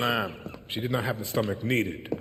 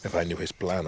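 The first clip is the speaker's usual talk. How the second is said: ordinary talk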